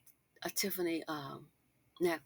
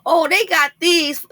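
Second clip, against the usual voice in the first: slow, much higher and louder mocking quote; mock dismissive; 10 semitones higher, 10 dB louder than usual. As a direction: mimic a careless gift giver. Mocking a cheap gift.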